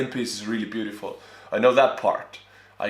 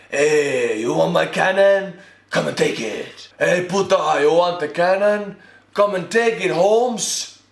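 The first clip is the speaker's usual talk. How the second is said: higher, hammed-up Texan dare; imitated Texan taunt; 5 semitones higher than usual. Texan daring the army to take the cannon, mock defiant. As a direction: taunt like a cocky Texan in parody. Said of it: "Texan people be like".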